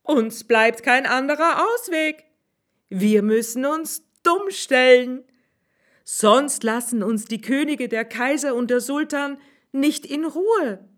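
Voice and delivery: a sudden bright idea; high swineherd, triumphant; swineherd's voice. Acted.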